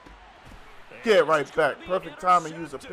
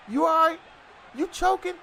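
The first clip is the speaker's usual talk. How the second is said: worried friend; 10 semitones higher, 4 dB louder than usual. Retold or imitated. Friend asks if he's choking.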